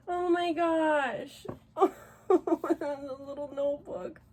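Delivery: moved almost to tears; teary. Real, not acted. Overwhelmed opening friend's gift.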